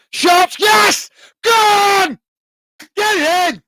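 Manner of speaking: high, loud, drawn-out celebration yell; excited goal shout. Real, not acted. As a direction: roar the goal in, voice leaping up. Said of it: "I'm getting a bit excited".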